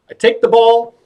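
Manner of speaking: high, sing-out showman line; magician's patter. Acted. Clown demonstrates trick to actor, high presenting voice. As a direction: pitch it up like presenting a prop.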